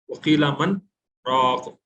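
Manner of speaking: "वकीला मन", held stop, then "राक"; brief breathless pause. Shown as a demonstration. Teacher repeating the sakta example, clear gap before "राक". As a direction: halt after "मन" without taking a breath. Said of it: "बगैर सांस तोड़े के रुकते हैं".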